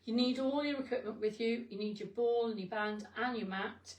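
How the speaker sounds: ordinary delivery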